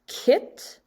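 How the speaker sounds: "kit" with a short ih vowel. Shown as a demonstration. Teacher models the short vowel for second graders, "kit" with ih. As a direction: say "kit" alone, crisp short I. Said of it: "for the short sound".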